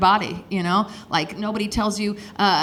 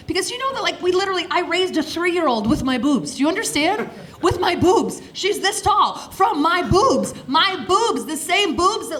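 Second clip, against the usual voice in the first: excited rant; 7 semitones higher than usual. Real, not acted. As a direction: build it up, thrilled and amazed.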